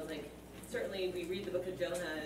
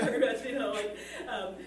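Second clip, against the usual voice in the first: talking through a real laugh, higher and louder; 6 semitones higher, 9 dB louder than usual. Real, not acted. Participant carrying on after a joking aside, laughing. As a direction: laugh carries on, then starts easing off.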